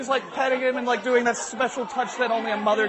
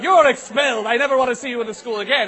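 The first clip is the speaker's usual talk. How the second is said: raised voice, higher, banishing; angry principal; 4 semitones higher, 5 dB louder than usual. Acted. Principal expels the kid, angrily. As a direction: pronounce the expulsion in a temper. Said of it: "He's like".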